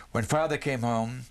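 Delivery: ordinary delivery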